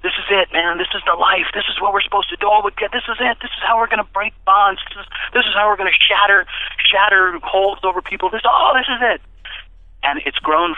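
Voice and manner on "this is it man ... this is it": excited. Retold or imitated. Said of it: "I was calling Alex".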